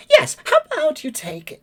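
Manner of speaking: very high, chirpy bird voice; high chirpy bird lady voice; pleasant bird lady. Acted. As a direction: chirp it like a bored bird receptionist. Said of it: "and after a moment she says".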